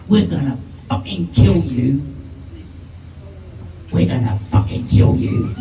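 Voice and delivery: lightly voiced threatening thug; menacing big guy. Big lad pinning a boy to the wall, menacing. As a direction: play the bully making a threat. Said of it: "They got that fucking menace in their face".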